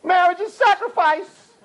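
put-on high, eager kid voice calling out; a keen student's mock shout. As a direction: play the smart kid blurting his answer. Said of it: "one kid screamed out".

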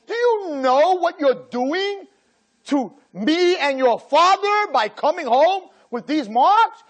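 slow, high, guilt-tripping rant; mock scolding parent. Rabbi imitating a parent guilt-tripping their child. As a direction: lay the guilt on thick, word by word.

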